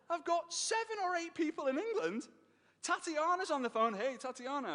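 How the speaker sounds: high, fast, giddy inner voice racing ahead; excited retelling. Retold or imitated. Pitching the business to Tatiana, eager and high. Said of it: "I was like".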